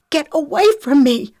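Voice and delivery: high, loud scream of protest. A narrator's reading. Lexi fights off her attacker, screaming. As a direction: scream at him to back off. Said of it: "she screamed".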